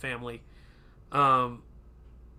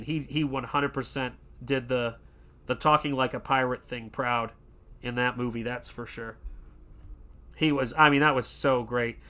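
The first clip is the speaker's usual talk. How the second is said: normal talking voice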